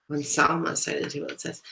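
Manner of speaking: plain reading voice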